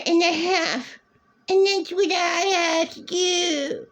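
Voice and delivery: put-on very high whiny baby voice; mocking whine. Mocking how the ex saw her at Wegmans, high and whiny. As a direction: whine like a spoiled brat.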